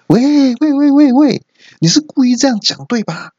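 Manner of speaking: high, shrill cartoonish exasperation; exasperated shrill voice. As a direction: squeal in disbelief at the blunder. Exasperated outburst at friend. (尖声抓狂的吐槽)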